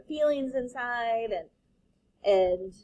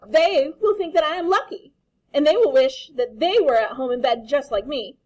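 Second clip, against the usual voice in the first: higher, loud smug retort; cheeky child; 5 semitones higher, 11 dB louder than usual. Acted. Daughter retorting to her father, high loud cheeky child. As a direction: gloat like a stubborn kid.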